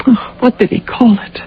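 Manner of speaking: hushed whisper to herself. Acted. Pilot recalling her husband's words, breathy whisper. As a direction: whisper it while searching memory.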